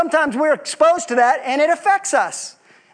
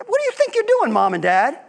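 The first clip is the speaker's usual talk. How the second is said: put-on squeaky high child's sass, dropping at the end; cheeky child; 4 semitones higher than usual. Preacher voices a child sassing his parents. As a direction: play a kid talking back to parents.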